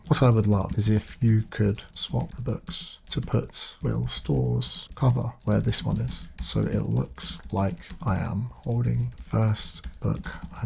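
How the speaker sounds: typing-along dictation voice; slow dictation. Real, not acted. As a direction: spell the request out slowly as you type. Dictating a prompt to ChatGPT.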